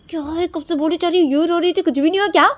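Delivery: very high, loud exasperated shouting; exasperated yell. Acted. Wife yelling she has said it again and again, exasperated. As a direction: scream out of patience.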